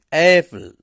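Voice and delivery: Apple said loud with the weight on uh; stressed model word. Shown as a demonstration. Teacher showing pronunciation to students, loud on uh. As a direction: punch the first syllable uh in Apple. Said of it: "উচ্চারণটা দেখে দিচ্ছি".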